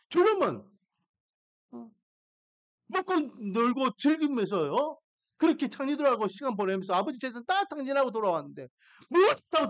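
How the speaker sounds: acted-out indignant protest, pitch often jumping up; angry complaining. Elder son protesting to his father, angry complaint. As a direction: play the aggrieved son arguing back. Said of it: "막 신균이 내면서 화를 내는".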